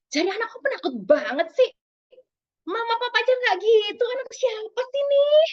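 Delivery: high, loud mock-exasperated parent; disapproving parent. Parent belittling a fearful child, high, loud exasperated scolding. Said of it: "ada lagi disapproving parent".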